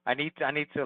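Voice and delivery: normal talking voice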